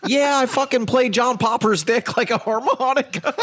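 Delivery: put-on high, gushing valley girl voice; bragging groupie. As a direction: brag like a starstruck groupie. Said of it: "this one girl's bragging like".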